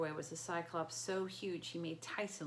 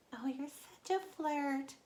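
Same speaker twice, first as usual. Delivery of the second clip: slow high coy voice; coy falsetto; 8 semitones higher, slower than usual. Acted. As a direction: play it coy and flirty. Grover playing coy bride.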